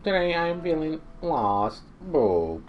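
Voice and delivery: lost little robot reporting in. Pretend. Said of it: "it is a robot".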